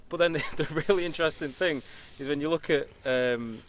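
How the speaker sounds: laughing while telling; words breaking into laughter. Real, not acted. Amused lead-in to anecdote.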